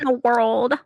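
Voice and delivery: big, loud trailer announcer; movie trailer voice. Shown as a demonstration. Retrying a trailer line after coaching, loud announcer voice. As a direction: boom it out like a film trailer.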